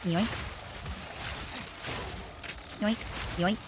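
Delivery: lightly voiced dirty old man; a hint of a creepy old man; leering old lecher. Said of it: "I sound like a dirty old man saying it that way".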